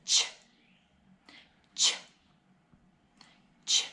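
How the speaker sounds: short sharp "ch" bursts, teeth together; ch sound. Shown as a demonstration. Teacher drilling the "ch" sound. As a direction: clip out each "ch" with a puff. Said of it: "C H says".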